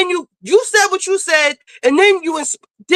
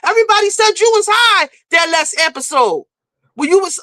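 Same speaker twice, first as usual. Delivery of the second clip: ordinary talk, nothing marked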